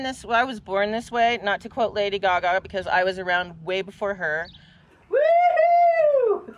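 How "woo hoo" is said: high excited whoop. Real, not acted. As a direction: cheer it out loud. Celebratory shout.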